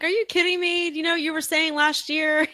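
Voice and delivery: high-pitched incredulous challenge; mock disbelief. Reacting to political hypocrisy, mock disbelief.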